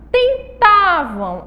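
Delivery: "tentavam" stretched out, high and loud; slow model word. Shown as a demonstration. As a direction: dictate "tentavam" slowly, sounding both nasals. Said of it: "não tem apenas a letrinha N".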